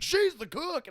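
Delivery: high, loud indignant yell; indignant shout. Retold or imitated. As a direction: snap back in defense of her. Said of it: "he was like".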